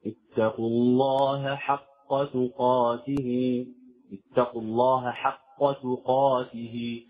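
slow recitation separating the ه of الله from the ح of حق; drawn-out tajweed model of الله حق; distinct ha letters. Shown as a demonstration. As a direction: recite الله حق slowly, both ه and ح clear. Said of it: "لا بد من إظهار الهاء والحاء".

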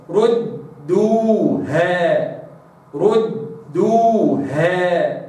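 ردوها very slow, doubled dal held with a full damma; Quranic recitation. Shown as a demonstration. Teacher models ردوها for students. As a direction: chant ردوها slowly, dal doubled and rounded. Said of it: "هكذا".

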